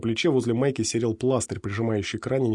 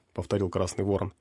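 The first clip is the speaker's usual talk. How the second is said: plain reading voice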